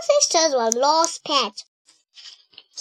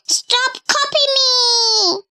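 annoyed big brother; 10 semitones higher than usual. A narrator's reading. Arthur annoyed at copying sister.

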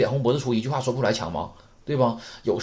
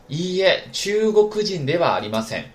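model reading; 6 semitones higher, 5 dB louder than usual. Shown as a demonstration. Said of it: "我们把这例子再读一下".

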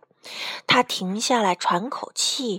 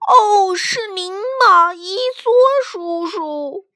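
high, loud, slow call of recognition; old man's high-pitched exclamation; crying out; 9 semitones higher, 6 dB louder, slower than usual. A narrator's reading. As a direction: cry out to your uncle, stretching the words. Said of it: "故事爷爷喊道".

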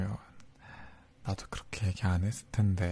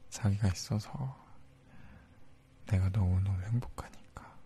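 whispering. Acted. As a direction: whisper the confession close. Intimate whispered confession.

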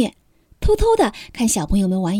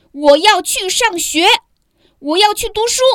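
determined announcement; 8 semitones higher, 5 dB louder than usual. A narrator's reading. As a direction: announce it firmly to parents. Child announces determined decision.

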